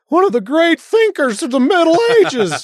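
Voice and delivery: high, exaggerated mock reverence; sarcastic. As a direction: gush over him with heavy irony. Mock praise of Aquinas.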